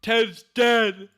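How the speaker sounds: high, loud hammed-up proclamation; over-the-top yelled announcement; mock dramatic yell. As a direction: shout it like a big shock reveal. Hammed-up announcement after the yelled boom.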